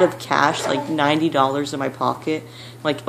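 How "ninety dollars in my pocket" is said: normal talking voice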